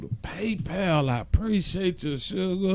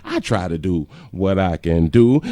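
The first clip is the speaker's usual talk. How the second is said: normal talking voice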